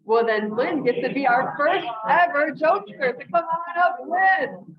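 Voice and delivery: loud, high announcer-style call-up; rousing introduction. Real, not acted. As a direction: build excitement as you call up the first contestant. Host calls first contestant up to perform.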